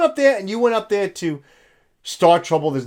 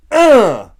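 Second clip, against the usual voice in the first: exasperated groan; 4 semitones higher, 9 dB louder than usual. Real, not acted. Exasperated in his rant.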